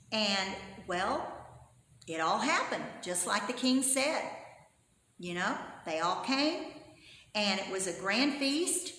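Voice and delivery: ordinary talk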